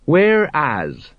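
drawn-out, effortful single word; slow, labored reading. A narrator's reading. Sergeant Colon starts on the scroll, reading slowly. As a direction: read it like a struggling reader. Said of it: "he read slowly".

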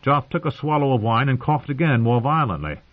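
plain reading voice

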